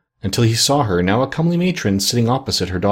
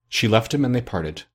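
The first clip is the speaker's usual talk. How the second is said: plain reading voice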